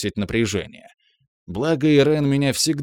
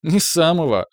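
tipsy smirking voice; laughing it off, drunk; 7 semitones higher, 4 dB louder than usual. A narrator's reading. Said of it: "усмехнулась Ирен".

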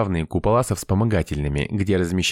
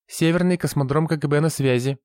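higher-pitched, hurried operator's patter; rattled off; 7 semitones higher than usual. A narrator's reading. Girl answers the call breathlessly, rattling it off. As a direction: rattle it off in a high voice. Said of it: "протараторила она".

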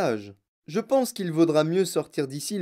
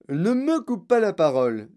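raised, higher reprimand; scolding; 5 semitones higher, 4 dB louder than usual. A narrator's reading. Wonka to Mike who interrupted, scolding. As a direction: cut him off firmly.

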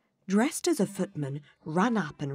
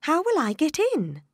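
put-on higher girl's voice; young Alice voice; 12 semitones higher, 6 dB louder than usual. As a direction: ask it like a curious girl. Alice speaks.

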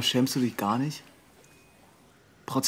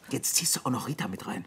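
whisper; 3 semitones lower, 6 dB quieter than usual. Acted. Son hisses during family quarrel.

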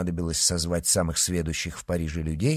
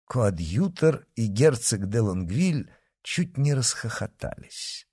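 plain reading voice

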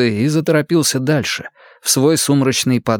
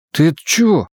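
confused mumble; 6 semitones higher than usual. Acted. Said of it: "пробормотал растерянно".

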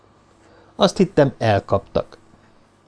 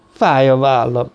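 slow, aching complaint in a changed voice; complaining. A narrator's reading. Professor complains to Cartwright of his aching shoulder, slow and whiny. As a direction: whine about the pain. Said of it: "panaszkodott a professzor".

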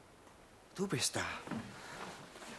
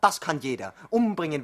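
louder, rising scornful outburst; agitated outburst; 3 semitones higher, 7 dB louder than usual. Acted. Hugo scorning Olga's bomb, agitated outburst. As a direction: spit the word out.

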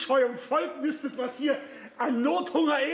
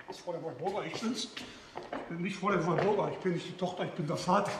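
low, much softer correction; hushed reply; 6 semitones lower, 6 dB quieter, faster than usual. Acted. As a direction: keep your voice down, low. Antwortet leise getarnt. (leise und tief)

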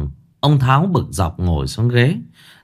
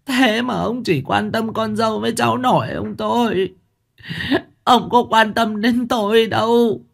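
sobbing complaint; 10 semitones higher than usual. A narrator's reading. Wife whimpers her grievances to husband, high tearful voice. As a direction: plead with sulky tears, pitched high. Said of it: "khóc nấc".

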